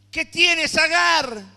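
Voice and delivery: loud voice of God. Acted. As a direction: boom the question down as God. God's question, loud retelling. (la voz de Dios, fuerte y alta)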